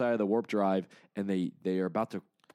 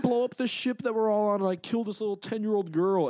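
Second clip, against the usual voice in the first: much higher and louder, anxious babble; imitating panicked Richard; 11 semitones higher, 5 dB louder than usual. Richard panicking to the World Mind, high anxious babble. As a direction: rush out the worst case like a scared kid. Richard fears using Nova Force.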